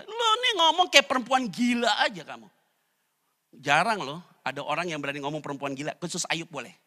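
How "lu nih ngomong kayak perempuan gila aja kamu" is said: Job rebuking wife. Acted.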